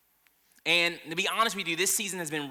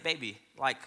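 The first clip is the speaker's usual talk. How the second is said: soft asking wife; 3 semitones lower, 10 dB quieter, faster than usual. Retold or imitated. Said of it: "And I said".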